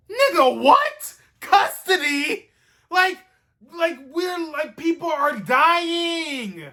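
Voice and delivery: indignant, can't believe the nerve; real incredulous rant, voice way up; outraged disbelief. Real, not acted. Reacting in disbelief to episode.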